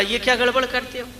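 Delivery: acted son's quick complaint; indignant protest. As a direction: object quickly in a high voice. Son protests to sweet-maker in parable.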